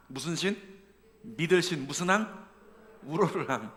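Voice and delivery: normal talking voice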